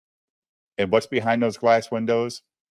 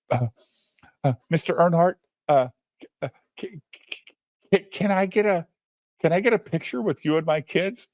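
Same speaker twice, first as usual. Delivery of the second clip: higher, stammering starstruck dad; mock nervous; 7 semitones higher than usual. Nervous dad asks his racing hero for a photo, nervously stammering. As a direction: stumble over the request, voice lifted. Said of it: "the most nervous thirty-year-old man".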